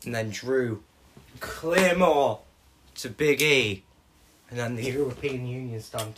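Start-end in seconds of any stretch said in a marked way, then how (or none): 1.4-2.4 louder, higher hype call; excited call of the move. Retold or imitated. A fan calling the Claymore on Big E with excitement. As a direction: shout the finisher like a commentator.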